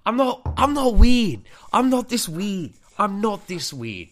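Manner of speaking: high, loud, drawn-out insistence; loud protest. Real, not acted. Storyteller denying he is weird, loud exasperated protest.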